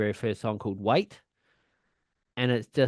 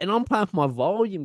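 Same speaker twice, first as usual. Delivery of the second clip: usual speaking voice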